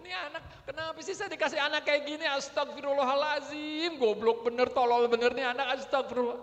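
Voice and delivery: put-on loud, high ranting at a child; hammed-up parent yelling prayers between insults; istighfar iblis. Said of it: "ini istighfarnya istighfar iblis kayaknya". (omelan orang tua yang berteriak marah)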